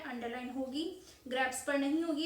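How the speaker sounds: ordinary talk, nothing marked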